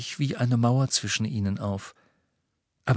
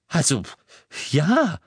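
louder, higher puzzled answer; slightly confused; 6 semitones higher, 4 dB louder than usual. A narrator's reading. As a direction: answer a bit thrown. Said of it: "offenbar leicht verwirrt". (leicht verwirrt)

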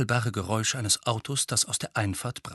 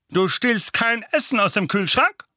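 higher, louder bullying uncle; gruff uncle voice; 10 semitones higher, 6 dB louder than usual. Acted. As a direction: bark orders like a bossy uncle. Uncle Vernon threatens Harry.

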